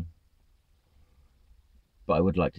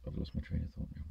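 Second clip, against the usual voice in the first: whispered aside; 3 semitones lower, 15 dB quieter than usual. Real, not acted. Losing his thread aloud.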